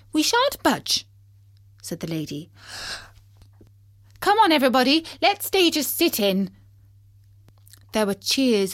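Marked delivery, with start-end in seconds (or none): 0.1-1.1 high, indignant refusal; stubborn passenger protest; defiant lady. Acted. Lady refuses to leave the bus. 4.2-6.5 high, loud call to everyone; indignant lady calling out; rallying cry. Acted. Lady rallies the passengers.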